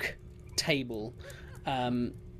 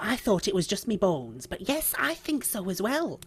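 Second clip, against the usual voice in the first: put-on higher-pitched, lilting old dear; chatty old woman Margaret; 6 semitones higher, 4 dB louder, much faster than usual. Old lady agreeing with her companion that it's late, fond and chatty.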